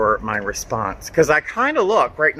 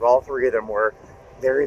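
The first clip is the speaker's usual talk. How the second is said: ordinary talk, nothing marked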